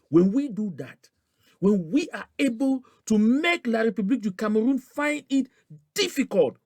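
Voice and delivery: high, loud impassioned speech, building; fiery rally oratory. Real, not acted. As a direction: preach it at full force to the crowd. Rally speech rising to a climax.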